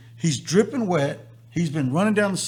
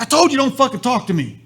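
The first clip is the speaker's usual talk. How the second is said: a mock yell, higher and louder, snapping at the driver; retold angry yell; 7 semitones higher, 5 dB louder than usual. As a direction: bark it back like an angry teammate in the car. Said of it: "he's yelling at the top of his lungs".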